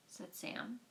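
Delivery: ordinary delivery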